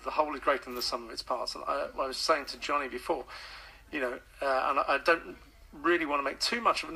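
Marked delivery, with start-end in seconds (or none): none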